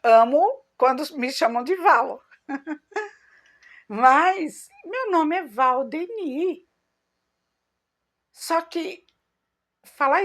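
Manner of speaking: laughing, pitch rising with pride. Real, not acted. Finally accepting her own name, laughing proudly. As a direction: laugh through it then claim the name proudly. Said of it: "eu falei".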